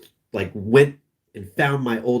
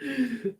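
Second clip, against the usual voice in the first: short high-pitched real giggle; a squeaky burst of laughter; helpless little laugh; 9 dB quieter than usual. Real, not acted. Cracking up mid-sentence.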